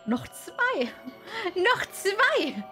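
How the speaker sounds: rising from eager to high-pitched excitement; excited exclamation. Real, not acted. Player sees two are still left to find, excited.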